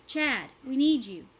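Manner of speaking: loud call. A narrator's reading. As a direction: plead across the gully. Calling Chad for help.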